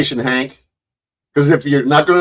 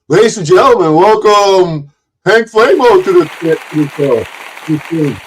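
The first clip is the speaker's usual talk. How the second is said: raised, drawn-out ring announcer intro; show announcer; 3 semitones higher, slower than usual. Pretend. Streamer bringing a rival guest on stage, announcer voice. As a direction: stretch the guest's name like an emcee.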